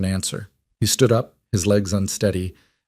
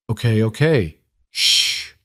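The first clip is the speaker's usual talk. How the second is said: hushing to calm her; slower than usual. A narrator's reading. Jack calming Claire.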